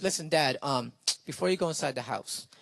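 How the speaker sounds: low, soft, halting plea to a dad; quietly butter up a father, acted; sheepish appeal. Son stalls dad with affection.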